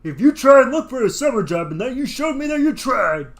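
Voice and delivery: loud lecturing father; stern dad voice. Acted. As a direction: lay down the law like a dad.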